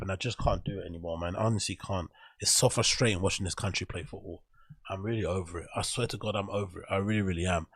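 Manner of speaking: low, soft, worn-out resignation; quietly over it, voice sunk down; fed up. Real, not acted. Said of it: "bloody annoyed and bloody fed up".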